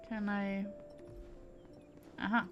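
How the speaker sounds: ordinary delivery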